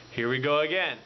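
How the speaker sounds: normal talking voice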